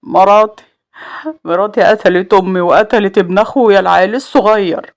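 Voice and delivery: grief-stricken, halting accusation; tearful. A narrator's reading. Salem telling the officer his wife killed them, tearful. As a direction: choke it out through tears. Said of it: "والدموع في عينه".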